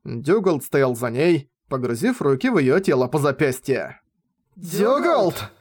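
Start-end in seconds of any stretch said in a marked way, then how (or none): 4.5-5.5 higher, shocked cry of a name; startled exclamation. Acted. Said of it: "воскликнула она".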